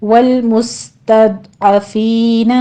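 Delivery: very slow chanted tajweed reading; measured Quran recitation, vowels held two counts; slow Quranic recitation. Shown as a demonstration. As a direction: recite it slowly as a model for students. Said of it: "عین کو وسط حلق سے نکالتے ہوئے".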